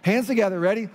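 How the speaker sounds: ordinary talk, nothing marked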